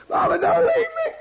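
put-on very high, loud wailing child; crying child voice. Child left at the babysitter's, wailing high and loud. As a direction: wail like a toddler left behind. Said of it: "you're going".

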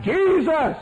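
high, ringing call of the name; crying out. Acted. Blind beggar crying out to Jesus passing by, high call. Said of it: "And he cried out".

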